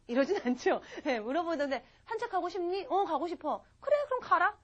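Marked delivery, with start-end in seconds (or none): 2.1-3.6 mock exchange. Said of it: "이거 아니죠". 3.8-4.6 a higher, louder brush-off; mock dismissive. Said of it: "이거 아니죠".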